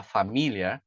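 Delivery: familiar with MI stressed instead of the final -ar; shifted stress. Shown as a demonstration. Teacher shows what sounds strange to listeners, familiar stressed on MI. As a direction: say fa-MI-liar, stress moved off the ending. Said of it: "Eu só mudei o, o acento".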